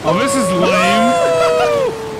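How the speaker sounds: high, loud, slow whine of disgust; loud complaint. Real, not acted. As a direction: stretch it out, fed up. Ride disappoints him.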